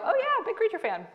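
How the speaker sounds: high, loud chirpy fake cheer; mock fan enthusiasm. Telling the checkout guy she's a fan, in a bright high voice. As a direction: play it up as the eager fan. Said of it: "And I go".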